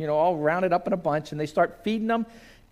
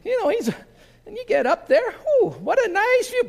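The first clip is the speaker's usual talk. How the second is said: much higher, louder, a trusting little sheep chattering happily; giddy high sheep voice with a laugh; naive sheep voice; 4 dB louder than usual. Acted. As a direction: play the cheerful, clueless sheep heading up the ramp. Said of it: "Now imagine you're the sheep".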